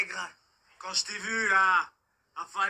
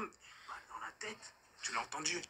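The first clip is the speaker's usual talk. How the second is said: soft whisper of menace; whispered threat; 5 semitones lower, 14 dB quieter than usual. Acted. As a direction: breathe it out like a secret. Agresseur chuchote la menace. (menace chuchotée)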